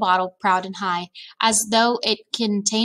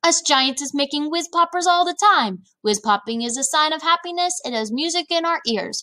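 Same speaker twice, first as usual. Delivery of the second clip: high-pitched, happy giant boasting; beaming delight; 7 semitones higher than usual. A narrator's reading. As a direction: explain it proudly with a beaming lift. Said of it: "beaming at her".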